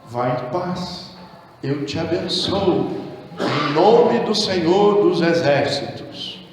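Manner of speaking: low, soft, slow pious blessing; mock church blessing. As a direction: bless them solemnly and emptily, hushed. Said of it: "a igreja responde".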